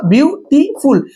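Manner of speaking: ब्यूटीफुल drawn out slowly, each syllable apart. Shown as a demonstration. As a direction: stretch ब्यूटीफुल into its three beats. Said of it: "इसमें तीन syllabus हुआ".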